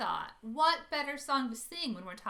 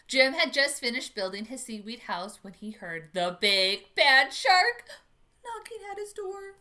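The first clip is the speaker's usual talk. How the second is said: put-on lively storybook reading for children; picture book read-aloud; 7 dB louder than usual. A narrator's reading. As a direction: read it to a room of kids.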